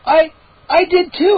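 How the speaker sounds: high excited exclaim. A narrator's reading. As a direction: exclaim it eagerly. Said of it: "I exclaimed".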